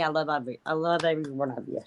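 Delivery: soft, choked-up, close to tears; teary-eyed. Real, not acted. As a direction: voice welling up with emotion. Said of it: "I'm gonna get teary-eyed".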